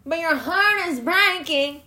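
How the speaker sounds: sneering mock pity; mock heartbreak. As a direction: parody a dramatic sob story. Mocking repeat of breaking heart.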